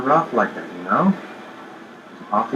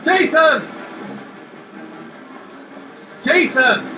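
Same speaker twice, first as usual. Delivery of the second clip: calling out; 12 semitones higher, much slower than usual. Acted. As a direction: call out for the lost boy. Father calling for lost son.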